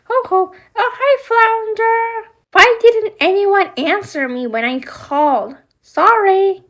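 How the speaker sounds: high, lively toy voices; doll play voices. Acted. Ariel greeting Flounder during doll play, high and lively. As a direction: voice the dolls meeting Flounder.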